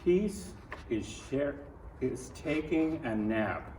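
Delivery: plain reading voice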